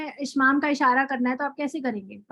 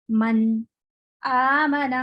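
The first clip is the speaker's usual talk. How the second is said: a brief stop after من, then آمن; light saktah; 4 dB louder, much slower than usual. Shown as a demonstration. Teacher demonstrates a saktah on من آمن. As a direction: catch the voice after من, keep the breath. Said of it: "ہلکا سا سکتہ کر سکتے ہیں".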